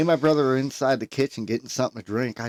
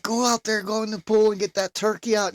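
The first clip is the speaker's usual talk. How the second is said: mock mom holler; 9 semitones higher than usual. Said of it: "my mom hollered at my oldest brother".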